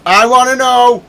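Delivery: high, loud, insistent outburst; loud demand. Real, not acted. Streamer demanding to know why the Capitol burns, loud and insistent. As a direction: cry out for an explanation.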